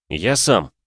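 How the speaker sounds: ordinary delivery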